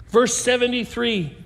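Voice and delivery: ordinary talk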